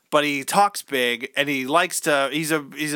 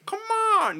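much higher jeering call; mock taunting dad. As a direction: goad the kid like a pushy coach. Coach father taunting son.

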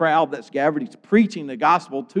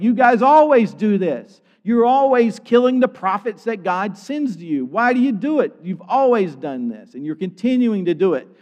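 higher, accusing retelling of a preacher's rebuke; exasperated rebuke; 5 semitones higher, 4 dB louder than usual. Retold or imitated. Stephen confronting the people who kill the prophets, exasperated rebuke. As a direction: scold them as Stephen, pitched up.

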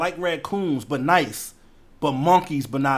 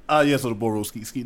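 lower, prim "Ah, yes, so the Boro skeet, skeet"; posh wrong way; 5 semitones lower than usual. Shown as a demonstration. Friend showing the wrong proper way, prim and low. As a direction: say it stiff and proper. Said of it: "You can't say".

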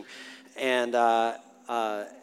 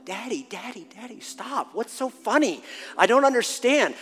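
desperate pleading daughter; 5 semitones higher than usual. Retold or imitated. Daughter wanting in on Dad's joke, desperate pleading. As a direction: imitate a daughter begging to be let in. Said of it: "she desperately wanted in on the joke".

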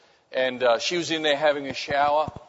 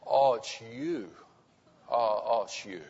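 relieved recognition; 4 semitones lower, 7 dB quieter than usual. Acted. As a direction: imitate a wife sinking into relief. Wife recognises husband's voice, relieved.

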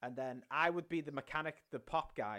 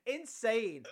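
ordinary talk, nothing marked

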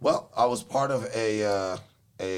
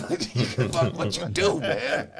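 laughing through the words, pitched up; 4 semitones higher than usual. Real, not acted. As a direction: laugh it out while saying it. Laughing line.

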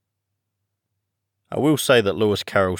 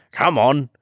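urgent call; 5 semitones higher, 4 dB louder than usual. A narrator's reading. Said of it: "the Gryphon only answered".

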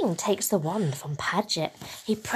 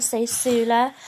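usual speaking voice

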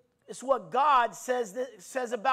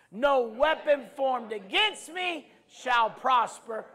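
preaching at full force; 3 semitones higher, 9 dB louder, much slower than usual. Acted. Preacher leading the congregation, full-force preaching. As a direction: proclaim each line like a battle cry.

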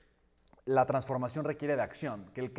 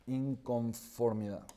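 slow, deliberate single word; lento y marcado; 4 semitones lower, 4 dB quieter, much slower than usual. Shown as a demonstration. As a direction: let the word sink in, slowly. Repite la palabra clave despacio. (despacio y enfático)

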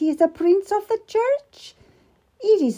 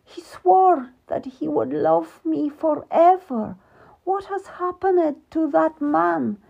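weeping; 10 semitones higher, 3 dB louder than usual. Acted. Abandoned queen alone, weeping for her husband. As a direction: grieve through tears for the lost husband. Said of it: "leaving Queen Catherine weeping".

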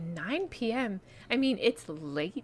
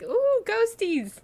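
high, playful spooky "ooh"; spooky ghost voice; 5 dB louder, slower than usual. Pretend. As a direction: tease it like a campfire ghost story. Mock spooky ghost story teasing.

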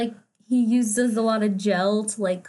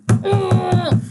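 frustrated groan; 7 semitones higher, 6 dB louder than usual. Real, not acted. Still furious about the scene.

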